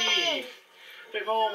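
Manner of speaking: high playful squeal; excited squeal. Acted. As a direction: squeal it up high to cheer a toddler. Dad cheering child's lemon squeeze.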